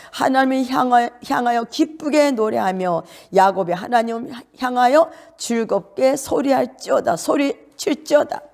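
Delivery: exultant preaching declaration; proclaimed psalm. Real, not acted. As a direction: call the psalm out high, slowing at the end.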